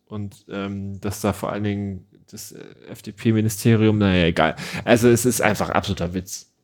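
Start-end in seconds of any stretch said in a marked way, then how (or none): none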